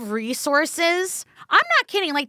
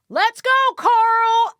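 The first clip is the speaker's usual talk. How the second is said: higher, slow drawn-out holler; rallying cop call; 7 semitones higher, 3 dB louder, slower than usual. Retold or imitated. Cops heading off to the storm drain, rallying call. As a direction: round up your partner to go dig.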